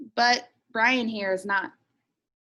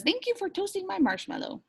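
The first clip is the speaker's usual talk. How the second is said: higher, quick little-guy voice; playful cartoon character; guy's voice; 9 semitones higher, 3 dB quieter than usual. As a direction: squeak it out as the small guy. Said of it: "He says".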